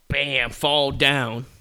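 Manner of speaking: ordinary delivery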